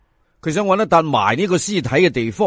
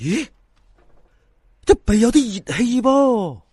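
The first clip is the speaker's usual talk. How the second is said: higher, jolted surprise; startled discovery; 6 semitones higher than usual. Acted. Fan feeling warm breath from the corpse, startled. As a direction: catch your breath at a shocking find.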